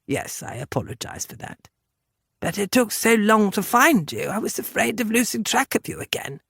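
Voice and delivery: lightly voiced contrite gentleman; hushed apology, then explain yourself; Englishman, apologetic. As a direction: apologise and plead your case.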